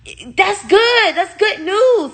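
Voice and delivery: high, cheerful mocking imitation of the doctor. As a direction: parrot her chirpy good news with a laugh. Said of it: "And she was like".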